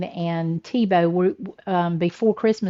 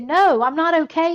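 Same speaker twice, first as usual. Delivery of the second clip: higher, emphatic mock distress; retold frantic upset; 8 semitones higher, 4 dB louder than usual. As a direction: imitate a flustered husband protesting. Retold husband frantic over lost gift.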